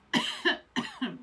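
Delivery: hard choking cough; real coughing fit, throat caught. Real, not acted. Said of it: "Sorry, I was choking really bad".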